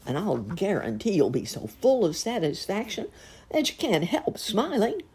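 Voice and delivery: a cheerful glutton coaxing, with a hint of a put-on voice; jolly fat man.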